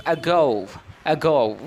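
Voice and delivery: ago with a weak "uh" start, "uh-GOH"; model pronunciation. Shown as a demonstration. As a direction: demonstrate ago as a teacher, first vowel reduced. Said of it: "We pronounce".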